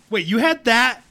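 acted incredulous outburst; mock incredulity. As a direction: call him out in mock disbelief. Imagined reaction to Dracula's history.

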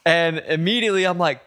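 ordinary delivery

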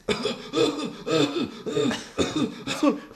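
high, choked mock coughing; drawn-out fake cough; imitated coughing fit. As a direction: hack like someone after a huge smoke hit. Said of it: "then he starts coughing".

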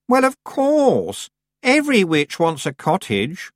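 high, slow, drawn-out superior voice; haughty Anagramma, self-assured. A narrator's reading. Anagramma claiming the cottage, haughty. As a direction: state the obvious loftily.

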